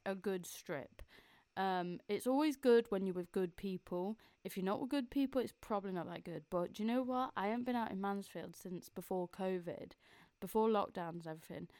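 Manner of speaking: ordinary talk, nothing marked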